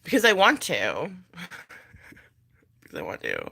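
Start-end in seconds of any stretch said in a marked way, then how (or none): 2.8-3.5 dropped far down, soft and low; playful low-pitched echo. Pretend. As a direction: repeat it in a deep, quiet undertone. Repeats the answer in very low voice.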